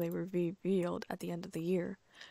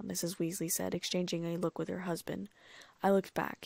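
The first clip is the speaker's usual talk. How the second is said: ordinary delivery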